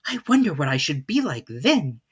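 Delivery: put-on a girl wondering aloud; lightly voiced Alice. A narrator's reading. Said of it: "said Alice to herself".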